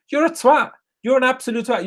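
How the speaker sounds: high, exasperated insult; real fan fury; angry outburst. Real, not acted. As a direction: spit it at him in disgust. Furious rant at opposing player.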